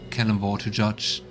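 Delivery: ordinary talk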